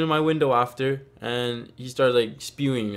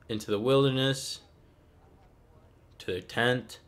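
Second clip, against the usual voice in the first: usual speaking voice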